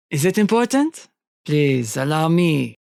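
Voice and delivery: put-on courteous French-accented villain. Hunter asking about the captive's ringing phone, polite French accent. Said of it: "sounded like a genuine French accent".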